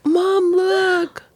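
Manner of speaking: put-on high little-kid voice; high-pitched kid's wonder; imitating excited child. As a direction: call Mom over in a child's high voice. Child showing birthday candle to mother.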